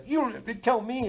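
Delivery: high whiny mock retort; defensive high-pitched brush-off; retorting himself. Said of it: "Sometimes I".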